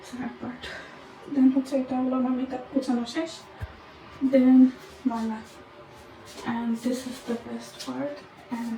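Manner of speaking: very soft, hushed room tour; hushed late-night voice. Real, not acted. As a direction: speak softly so as not to disturb the night. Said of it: "আমি অনেক আস্তে আস্তে কথা বলতেছি". (খুব আস্তে, চাপা গলায়)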